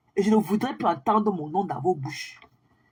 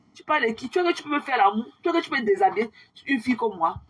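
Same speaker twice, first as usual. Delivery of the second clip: ordinary talk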